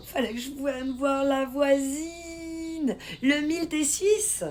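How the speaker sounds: high, drawn-out delighted call; playful excitement. Real, not acted. Streamer learns a viewer is a Swiss neighbour, playful excitement. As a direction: squeal it out, stretching the last word.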